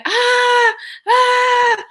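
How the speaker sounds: high mock scream of a frantic caller; a high panicked "ah"; imitated caller screaming. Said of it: "people call all the time screaming".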